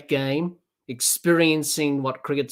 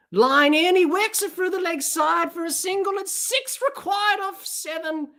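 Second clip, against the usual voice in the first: breathless live commentary, much higher; excited play-by-play; 13 semitones higher than usual. Real, not acted. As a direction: call the boundary like the crowd's on its feet. Resumes ball-by-ball call.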